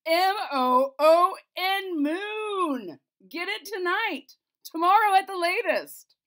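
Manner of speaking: loud, high, slow spelled demand; king spelling out his demand to the Chamberlain, loud and high; spelling it out loudly. Acted. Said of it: "said the king".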